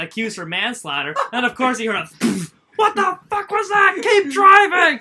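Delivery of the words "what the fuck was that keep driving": high, loud, stretched-out scream; furious boss yell. Retold or imitated. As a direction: imitate an outraged boss screaming orders. Boss yelling at driver, retold.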